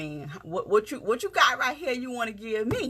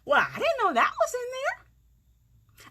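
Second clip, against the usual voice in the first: high-pitched, laughing, amazed; surprised delight; slower than usual. Retold or imitated. Recalling her surprise at what her writing revealed, laughing and high. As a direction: gasp out the discovery with a laugh, much higher. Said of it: "I'm like".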